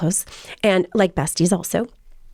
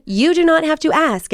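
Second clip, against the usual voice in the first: every word of "do not have to ask" pressed, high; emphatic insistence; 8 semitones higher than usual. Retold or imitated. Mom tells son he is always welcome, "not" firmly stressed.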